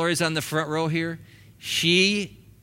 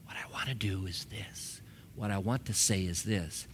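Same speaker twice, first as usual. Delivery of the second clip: soft whisper of a patient inner voice; the Spirit whispering beneath all the emotion; Holy Spirit whispering; 6 semitones lower, 11 dB quieter than usual. Acted. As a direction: whisper it under the noise. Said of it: "Holy Spirit's out here going".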